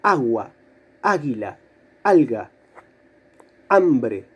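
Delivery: "Agua, águila, alga, hambre" each stressed on the first A; falling, separated "Agua, águila, alga, hambre", weight on each opening A; stressed initial A. Shown as a demonstration. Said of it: "empiezan por la A o la H A fuerte".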